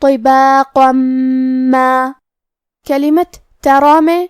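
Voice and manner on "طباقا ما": طباقا ما merged with a doubled nasal م; tajweed demo. Shown as a demonstration. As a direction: run طباقا into ما with ghunna. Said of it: "غنہ اور تشدید کے ساتھ ادا کریں گے".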